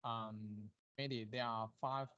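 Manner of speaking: faint, far from the mic; barely audible voice; too quiet. Real, not acted. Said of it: "your voice is very low".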